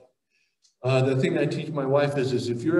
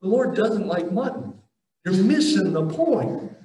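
higher pitched prophet, scolding the people for missing the point; a pitched-up, incredulous rebuke; exasperated Isaiah; 7 semitones higher than usual. Retold or imitated. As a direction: play Isaiah throwing up his hands at the foolish crowd. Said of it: "Isaiah ended up having to come ... and be".

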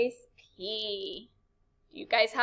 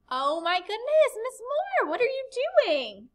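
mock shocked; 10 semitones higher than usual. Teacher playing student, gasps at her own missing exclamation point. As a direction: pitch jumps far higher in mock dismay at her own slip.

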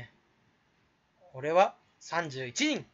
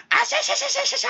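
high rapid ashashasha cackle; cackling laugh imitation; 3 dB louder, faster than usual. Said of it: "って言われてんじゃん". (甲高い早口の笑い声のまね)

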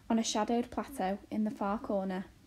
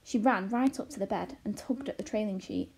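usual speaking voice

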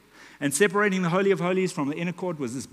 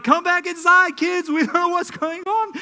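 frantic call; 12 semitones higher, 8 dB louder than usual. Retold or imitated. Parents calling kids in, panicked.

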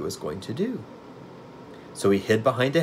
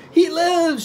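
very high, loud, ecstatic shriek; screamed; 7 dB louder than usual. A narrator's reading. Witch seeing her creation come alive, delighted scream. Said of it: "she screamed".

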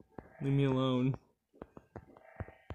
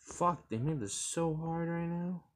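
ordinary talk, nothing marked

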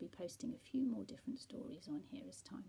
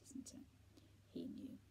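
ordinary delivery

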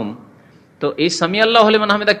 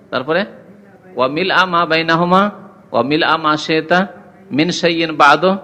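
usual speaking voice

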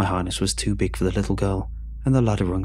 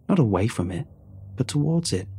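plain reading voice

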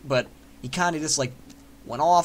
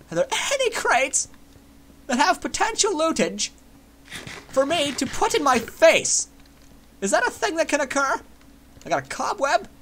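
much higher goofy character voice; silly put-on voice; 12 semitones higher, 3 dB louder than usual. Gamer searching crates for viewers, silly high voice. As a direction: squeak it out like a cartoon. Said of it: "I don't know why I'm still in this voice".

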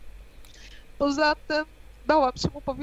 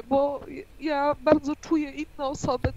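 normal talking voice

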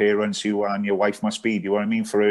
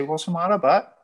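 higher, concerned question to the wife; lightly voiced worried query; asking what's wrong; 9 semitones higher than usual. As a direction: pitch it up like a puzzled husband. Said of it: "I say".

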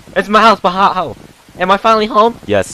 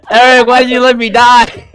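loud high laughing yell; laughing shout; 7 semitones higher, 5 dB louder than usual. Real, not acted. Dies in game, yells at friend.

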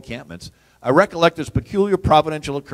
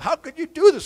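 mock outraged cry; 12 semitones higher, 4 dB louder than usual. Uproar in the British camp.

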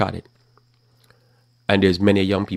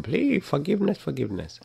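high pleading whine mocking a wimpy Christ; mock begging; 8 semitones higher, 3 dB quieter than usual. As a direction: beg pathetically, then fade. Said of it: "Christ is there begging".

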